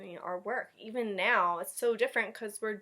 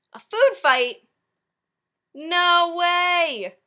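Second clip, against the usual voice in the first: loud, high disbelief; astonished; 8 semitones higher, 14 dB louder, much slower than usual. Real, not acted. Reader amazed at the food fight, loud and high. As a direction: exclaim it in shock.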